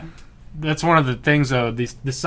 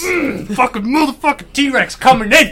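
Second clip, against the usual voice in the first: high, loud, hyped entrance call; hyped announcer; 12 semitones higher, 5 dB louder, slower than usual. Retold or imitated. Retelling his reaction in the theater, loud hype-man announcement. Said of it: "I was like".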